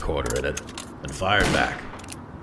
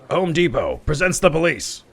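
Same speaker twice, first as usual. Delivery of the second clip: higher, louder bold announcement; announcing police; 13 semitones higher, 6 dB louder than usual. Acted. Detective announces himself to the office workers, loudly. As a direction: burst in like a cop declaring himself.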